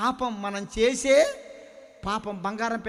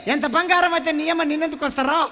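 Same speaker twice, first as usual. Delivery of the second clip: gold refusing scornfully; 7 semitones higher, 9 dB louder than usual. Acted. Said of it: "అప్పుడు బంగారం అంటుందంట".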